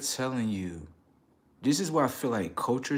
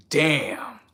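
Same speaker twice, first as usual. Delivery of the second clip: higher, louder drawn damn; exasperated damn; 5 semitones higher, 8 dB louder than usual. Real, not acted. Reacting to the culture talk, loud exasperated damn. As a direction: let it land in disbelief. Reacting to what people reveal.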